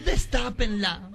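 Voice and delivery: slow, tearful plea; slow sobbing plea. Acted. Daughter tearfully asks them to open the coffin, slow sob. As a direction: beg through sobs.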